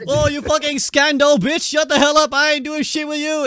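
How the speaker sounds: high, loud retold tirade; mock angry retort. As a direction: fire back like an offended player. Acted retort in nationality quarrel.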